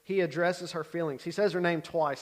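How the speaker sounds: usual speaking voice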